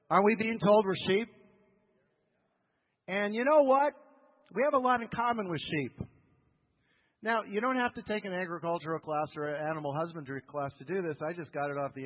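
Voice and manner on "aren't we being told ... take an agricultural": louder, higher preacher voice; preaching at full force. Acted. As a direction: rouse the congregation.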